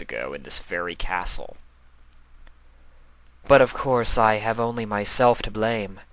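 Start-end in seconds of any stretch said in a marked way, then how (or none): 3.5-6.0 lightly voiced Luna, a bit higher and louder; rueful self-reproach in a lightly put-on voice; reminding herself. A narrator's reading. Luna reminding herself where the blame lies, lightly voiced. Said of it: "she reminded herself".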